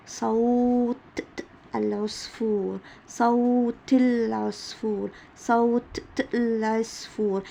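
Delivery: صوت العصفور said very slowly with the ت kept as ت; teacher slowly showing ت kept in صوت العصفور; slow linking demo. Shown as a demonstration. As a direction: spell out صوت العصفور, keeping the ت in the link. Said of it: "لما أجي أقول".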